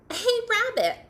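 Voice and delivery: high, loud call across to a friend; demanding storybook shout. Acted. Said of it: "shouted Bird".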